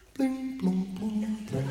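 chime sound. Retold or imitated. Imitating a shop chime.

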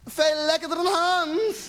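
high, loud, drawn-out scream. Acted. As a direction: scream it slowly in mock ecstasy. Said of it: "En ze schreeuwt". (hoog en langgerekt schreeuwen)

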